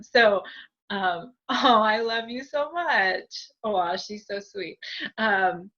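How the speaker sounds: ordinary delivery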